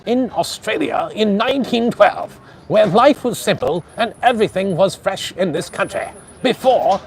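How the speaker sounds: put-on high, loud old-time radio announcer voice; fifties radio announcer. Imitating old Australian radio shows for livestream viewers, vintage announcer voice. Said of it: "they kinda sounded like this".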